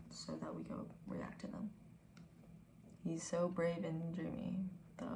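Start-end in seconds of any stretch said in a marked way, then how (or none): 0.1-1.7 whispering. Real, not acted. Quiet aside to roommate.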